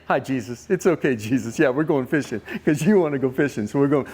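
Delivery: low, put-on compliant voice; outwardly agreeable. Peter facing Jesus while hiding his anger, outwardly agreeable. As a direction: cover the resentment with easy agreement. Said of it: "act a whole other way outside".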